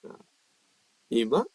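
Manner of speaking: normal talking voice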